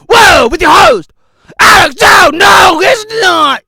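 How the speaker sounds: loud, high announcer welcome; hyped host welcome; shouted show welcome. Acted. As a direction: announce the show at full blast.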